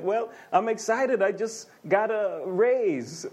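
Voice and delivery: hammed-up excited voice; mock excitement. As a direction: act the giddy new-raise spender.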